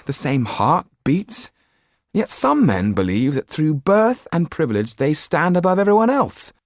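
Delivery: put-on young Jan, impassioned. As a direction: preach your ideals to the room. Narrator voices young Jan.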